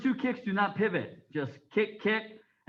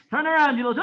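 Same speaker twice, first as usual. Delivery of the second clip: loud, high drill call; shouted command; 6 semitones higher, 7 dB louder, much faster than usual. Real, not acted. Instructor ordering students to turn around, shouted. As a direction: call it across the class.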